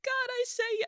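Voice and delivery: high, loud mock exclamation of surrender; imitating friend's testimony. Cousin imitating her testimony video, high hammed-up cry. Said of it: "you somewhere just being like".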